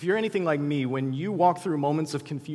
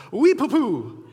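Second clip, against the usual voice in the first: high, loud "Oui, poupou" in a goofy Joey voice; Joey gets it wrong; 10 semitones higher, 9 dB louder, slower than usual. Acted. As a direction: blurt the garbled French with confidence. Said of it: "Totally gets it wrong".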